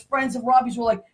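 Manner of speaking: ordinary talk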